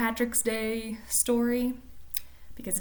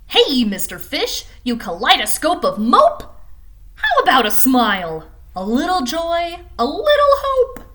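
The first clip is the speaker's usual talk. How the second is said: louder, climbing higher, cheeky squid; pushy squid; 6 semitones higher, 10 dB louder than usual. Acted. The squid teases the fish to smile, loud and rising. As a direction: needle him with a rising taunt.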